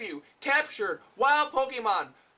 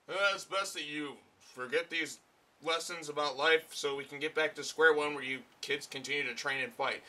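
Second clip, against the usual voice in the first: mock Mewtwo; 6 semitones lower, 6 dB quieter than usual. Mocking Mewtwo's reasoning.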